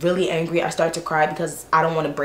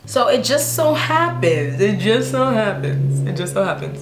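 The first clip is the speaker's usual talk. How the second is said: teasing suspense; 3 semitones higher, slower than usual. Real, not acted. Storyteller setting up a coincidence, drawn-out and knowing.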